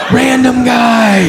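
high, slow, drawn-out shout of panic; panicked cry. Acted. Comic realizing who is phoning, panicked shout. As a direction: cry it out in hammed-up alarm. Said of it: "Now I'm panicked".

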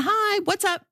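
high, clipped-short imitation of mom; a quick hi from a busy mother; brisk mom. Host imitating her mother's brief phone greeting, high and brisk. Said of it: "It's always been".

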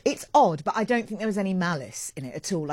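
ordinary delivery

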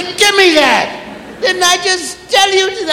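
lightly voiced exasperated parent. Parent scolds child again.

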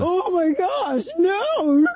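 high, slow fake gasp of outrage; sarcastic pearl-clutching "oh my gosh, no!"; mock horror. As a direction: ham up the shock, way up high.

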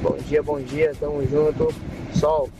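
usual speaking voice

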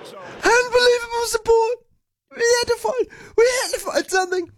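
high, strained lost-voice croak; imitating Harry Kane. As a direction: push a wrecked voice up high. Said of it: "trying to imitate Harry Kane".

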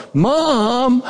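put-on high call of "Mom!" like a scared five-year-old; child calling out. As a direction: cry out for mom in the pitch dark. Child calls out in dark cave.

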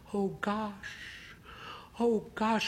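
high, breathless flustered voice; losing control, hands flailing; flustered panic. A narrator's reading. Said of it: "her hands flail".

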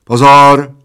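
loud, drawn-out starter's call; commanding. A narrator's reading. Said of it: "zavelel Plešatec".

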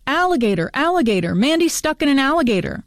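high, swooping yell without added volume; narrator's restrained girlish yell; mock yell. A narrator's reading. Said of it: "at the same time yells".

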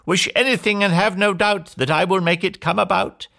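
high-pitched magic fairy voice; high, grand fairy promise; fairy's cry. A narrator's reading. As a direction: proclaim the wish from on high. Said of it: "she cried".

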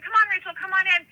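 high, quick coaxing; high-pitched mimicked urging; beckoning call. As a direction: hurry someone in, imitating the cousin. Cousin waving her in, retold.

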